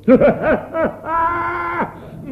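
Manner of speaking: a loud, high burst of laughter. Acted. (luide, hoge lachsalvo)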